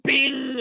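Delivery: loud high bing noise; mock scale bing. Comic stepping on the doctor's scale, loud high bing. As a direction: yell the scale sound like it broke.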